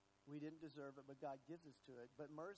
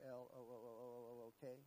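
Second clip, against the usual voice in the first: stretched out; 3 semitones lower, faster than usual. Shown as a demonstration. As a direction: stretch every letter of the word look. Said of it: "Stretch it out just a little bit".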